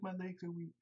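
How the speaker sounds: mock helpless squeak; feeble whimper. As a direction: whine it like you're collapsing. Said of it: "He's like".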